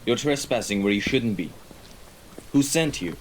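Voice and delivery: loud, demanding interrogation; sharp challenge. Acted. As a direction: challenge the trespasser, building in force. Confronting an intruder in the shadows.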